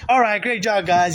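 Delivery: acted encouraging coach; coach after game. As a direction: congratulate your players once it's over. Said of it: "when the game, the, the game's over".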